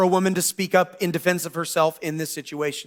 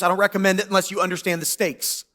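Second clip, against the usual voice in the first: ordinary talk, nothing marked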